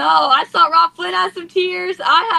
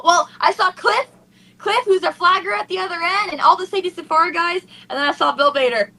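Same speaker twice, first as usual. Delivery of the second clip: ordinary talk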